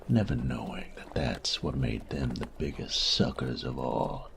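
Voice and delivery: ordinary delivery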